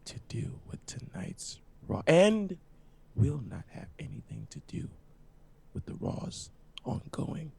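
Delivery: hushed, drawn-out words close to the mic; slow and deliberate. Shown as a demonstration. Podcaster setting the chat straight, slow and deliberate. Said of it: "I just wanna say this slowly".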